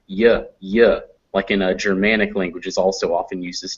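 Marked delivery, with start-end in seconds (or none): 0.1-1.0 "y" as a short yuh, as in yes; Y sound. Shown as a demonstration. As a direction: sound out "y" as yuh, not the letter name. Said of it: "J makes the Y sound".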